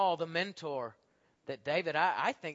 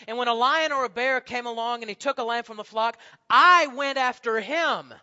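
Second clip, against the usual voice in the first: bold fierce boast; 7 semitones higher, 8 dB louder than usual. Acted. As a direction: boast like a young fighter. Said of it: "David says".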